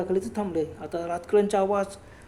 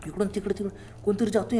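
ordinary delivery

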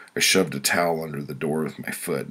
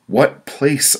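growled; 11 semitones higher, 8 dB louder than usual. Acted. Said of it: "I growled".

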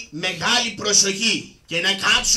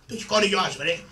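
normal talking voice

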